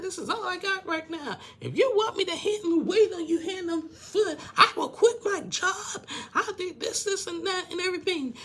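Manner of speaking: very high, indignant mock tirade; loud, worked-up imitation of an argument; angry rant. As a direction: act out an outraged wife yelling back. Acting out Rebecca's furious rant.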